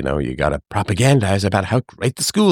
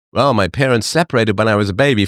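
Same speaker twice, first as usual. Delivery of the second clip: normal talking voice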